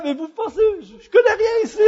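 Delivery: put-on high, loud protest in Québécois accent. Lost driver answering angry followers in Québécois accent. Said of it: "je sors mon accent québécois".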